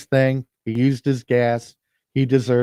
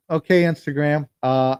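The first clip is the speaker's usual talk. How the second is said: normal talking voice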